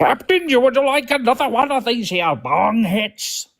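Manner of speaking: high, slow pirate drawl. Pretend. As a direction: play a drawling old pirate. Offering drugs on pirate boat.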